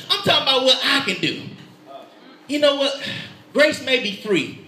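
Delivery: ordinary talk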